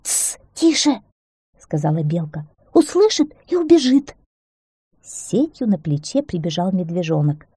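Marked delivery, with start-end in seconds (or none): 0.0-1.0 high, slow shush; hushing. A narrator's reading. Squirrel hushes the shouting hare. 2.7-4.2 high-pitched squirrel voice, emphatic; high, loud cautioning; urgent warning. A narrator's reading. Squirrel warns the shouting hare.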